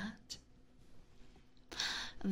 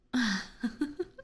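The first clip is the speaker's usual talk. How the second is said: breathy teasing chuckle; 5 semitones higher than usual. Acted. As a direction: chuckle at the prisoner's plight. Vampire amused by her captive.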